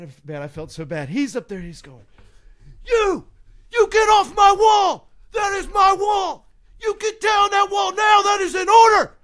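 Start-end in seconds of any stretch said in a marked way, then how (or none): 2.9-9.1 full-force, high bellowed orders; drill sergeant yelling. Retold or imitated. Sergeant atop the wall ordering a frozen student down, acted full-out yelling. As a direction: scream it like a furious drill sergeant, drawing out the words. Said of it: "finally he stops yelling".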